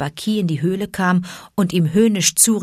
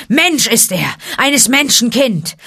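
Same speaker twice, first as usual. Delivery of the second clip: loud, raised accusation; roaring; 7 semitones higher, 5 dB louder than usual. A narrator's reading. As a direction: hurl it out as a furious charge. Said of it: "Da brüllte Shere Khan". (laut und anklagend gebrüllt)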